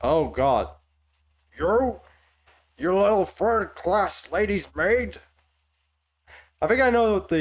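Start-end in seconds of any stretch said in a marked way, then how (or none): 1.6-5.2 put-on higher, slow outraged line in an imperfect Heavy accent; attempted Heavy accent. Reader accusing a lady's maid, half-managed Heavy accent. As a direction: attempt Heavy's accent, pitching each word up. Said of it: "it's the accent, because I'm not doing it".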